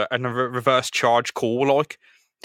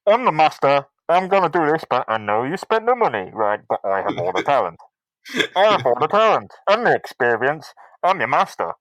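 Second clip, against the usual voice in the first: pompous villain. Retold or imitated. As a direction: brag about your talent like an arrogant stage magician. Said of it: "Mysterio comes in and goes".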